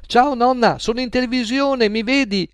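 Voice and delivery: put-on little boy calling out; excited child calling. A narrator's reading. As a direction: wave and shout to grandma on TV. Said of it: "gridò un bambino".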